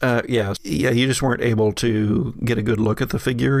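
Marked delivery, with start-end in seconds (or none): none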